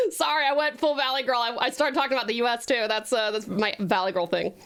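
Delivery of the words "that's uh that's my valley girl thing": ordinary talk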